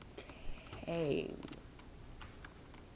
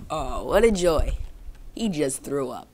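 swooping, loud exasperated complaint; loud dismay; 12 dB louder than usual. Real, not acted. Owner reacting to dog throwing up, loud dismay. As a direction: groan it out loudly in dismay.

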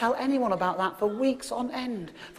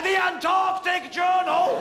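louder and higher, slow and grand; grand announcement; 6 semitones higher, 5 dB louder, slower than usual. Real, not acted. Comic presents his find to the audience with a loud, slow, grand announcement. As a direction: unveil it like a prize to the crowd.

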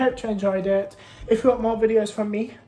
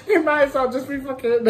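high laughing talk; 4 semitones higher than usual. Real, not acted. Laughing through the words.

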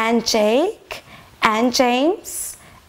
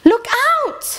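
cried warning; 10 semitones higher than usual. Acted. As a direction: shout the warning high. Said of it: "she cried".

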